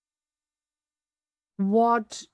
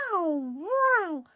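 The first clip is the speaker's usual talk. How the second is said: excited wow climbing much higher; enthusiastic, voice going up; 6 semitones higher than usual. Shown as a demonstration. Guest mimics own excited podcast reactions, enthusiastic rising voice. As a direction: imitate your own enthusiasm, pitch leaping up.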